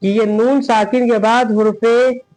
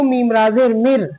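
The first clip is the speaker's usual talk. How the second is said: ordinary talk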